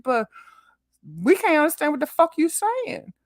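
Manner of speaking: usual speaking voice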